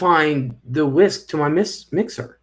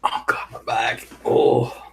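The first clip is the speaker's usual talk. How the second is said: pained groan; 3 semitones lower than usual. Real, not acted. Groaning about her back pain.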